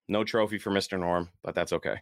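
ordinary delivery

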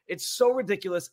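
ordinary talk, nothing marked

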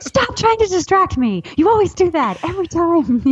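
high, whiny imitation of an irritated husband; imitating husband's complaint; mock annoyed husband. Husband annoyed during argument.

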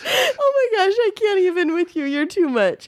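real laughing fit, high voice settling down; helpless laughter. Real, not acted. Wife giving up on her joking husband, helpless with laughter. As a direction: squeal it out while recovering from laughter.

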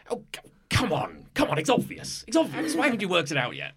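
an irritated giant piece scolding; loud impatient voice. Acted. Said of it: "you actually hear quite a loud voice".

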